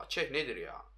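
ordinary delivery

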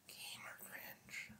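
slow breathy whisper, barely voiced.

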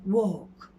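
"Walk." as "wawk", long vowel; silent l in walk. Shown as a demonstration. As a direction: say "Walk." with the l left out. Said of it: "L yerine yumuşak G varmış gibi".

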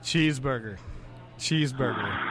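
reluctant, low-pitched word on request; lightly sultry, half-hearted and low; mock sultry. Host humouring a phone caller on request, mock sultry. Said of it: "go real slow and sultry".

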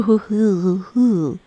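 a lightly put-on posh British laugh; bad British accent. Crafter voicing her clay snail as a fancy British man, light posh British accent. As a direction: ham it up as a fancy British gent, hoo hoo. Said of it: "That's not a good accent".

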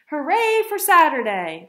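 loud, high, joyful cheer. Acted. Mother and Ava at the theater, cheering loudly. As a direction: cheer it out with gusto. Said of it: "they cheered".

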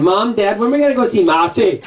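put-on high-pitched child voice, Scottish "Matty"; imitated kids. Niece and nephew asking parents about the dog, imitated child voice. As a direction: pipe up like an eager kid. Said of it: "the kids would be talking".

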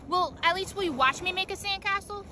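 ordinary talk, nothing marked

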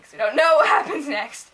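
ordinary talk

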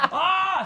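high, laughing mock shriek. Learning the other Bianca lives, laughing shriek. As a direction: squeal at the news. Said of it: "And I was like".

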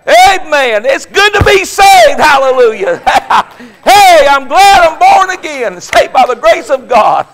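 high, loud hallelujah shouts; exultant revival shouting; joyful shouting. Real, not acted. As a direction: shout your praise out.

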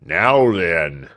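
sour, curt servant's reply, drawn out; discontented manservant voice; unceremonious servant. A narrator's reading. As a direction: answer a summons grudgingly, without ceremony. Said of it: "unceremonious acknowledgment".

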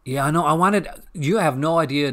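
loud eager outburst; excited. Real, not acted. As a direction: burst out keenly, voice up. Excited reply to viewer comment.

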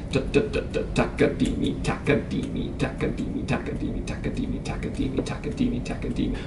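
soft, toneless "takadimi" chant; quiet rhythmic counting. Shown as a demonstration. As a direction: murmur the syllables evenly along with tapping hands. Demonstrates the tapping rhythm.

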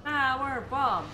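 Power bomb slow and grand; drawn-out power bomb. Shown as a demonstration. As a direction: stretch out Power bomb announcer-like. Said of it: "give us our power bomb".

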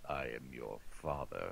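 deep, soft villain reveal; Liquid impression. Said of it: "Cam Clark as the voice of Liquid".

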